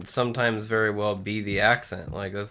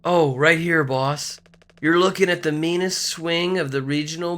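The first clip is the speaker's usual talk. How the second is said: drawn-out, slightly higher crewmate voice; boastful game character; 6 semitones higher, 4 dB louder than usual. Acted. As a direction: brag like an eager crewmate, stretching the words.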